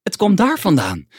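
high, loud calling out; urgent call. A narrator's reading. Moomin locates the scream for Snif, urgent high call. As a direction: call it out in alarm. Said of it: "riep Moomin".

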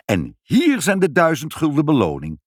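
high, generous flourish; passionate, delighted. A narrator's reading. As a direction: present the reward effusively. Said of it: "ambassadeurs zijn heel hartstochtelijk".

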